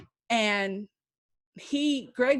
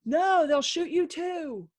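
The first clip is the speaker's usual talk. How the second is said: urgent warning; 8 semitones higher, slower than usual. Retold or imitated. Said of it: "And I said to him".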